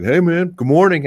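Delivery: high, bright fake-friendly hello; mock cheery greeting. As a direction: chirp it like a too-cheerful stranger. Imitating cheery transient greeting.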